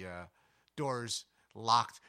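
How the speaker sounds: ordinary talk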